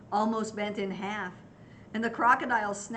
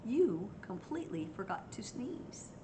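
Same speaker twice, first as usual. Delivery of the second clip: soft whispered reveal; 6 dB quieter than usual. Acted. Mouse telling the elephant, whispered. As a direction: whisper it like a secret.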